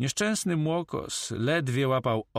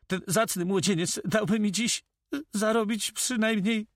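high-pitched, halting notary voice; nervous notary; 12 semitones higher than usual. Acted. Notary complains of lost earnings to the corsair, high and nervous.

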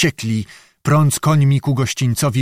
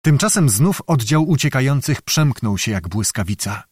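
usual speaking voice

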